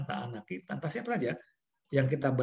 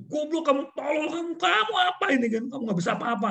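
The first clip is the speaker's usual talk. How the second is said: high, raised insulting tirade; abusive cursing; 11 semitones higher than usual. Shown as a demonstration. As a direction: berate the worker with insults. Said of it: "kalau memaki tuh".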